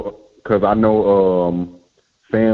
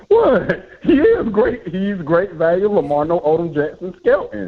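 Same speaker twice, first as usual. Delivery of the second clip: mock innocent; 6 semitones higher than usual. Real, not acted. Defending his joke pick.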